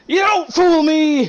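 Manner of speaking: high, loud, frantic accusing shout; panicked shout. Acted. Shopkeeper threatening the customers with a sword, frantic high shout. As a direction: yell it at the strangers, terrified and cornered. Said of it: "and he's like".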